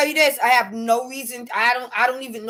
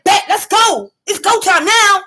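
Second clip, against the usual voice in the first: raised, eager call to fight; loud, higher-pitched mock bravado; mock fighter's challenge; 5 semitones higher, 5 dB louder than usual. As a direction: imitate someone squaring up, loudly. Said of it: "it's like".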